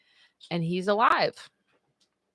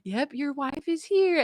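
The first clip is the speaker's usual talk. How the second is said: lightly voiced nurse, higher pitch; a hint of a reassuring nurse, pitched up; 7 semitones higher, 4 dB quieter than usual. Nurse answers the groggy patient, lightly voiced and higher. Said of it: "the nurse is like".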